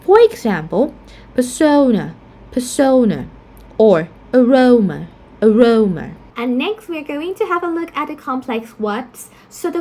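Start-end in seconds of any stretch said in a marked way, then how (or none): 1.3-3.3 persona stressed on SO, falling; stress on second syllable. Shown as a demonstration. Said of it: "pronounce the second syllable stressed". 4.3-6.1 aroma stressed on RO, falling; stress on second syllable. Shown as a demonstration. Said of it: "pronounce the second syllable stressed".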